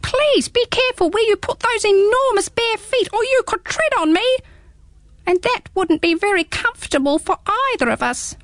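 squeaky small creature, teasing; little hedgehog voice. Acted. Hedgehog speaks in story.

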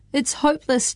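ordinary delivery, nothing marked

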